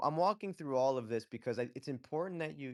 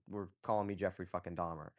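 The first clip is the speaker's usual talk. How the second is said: ordinary talk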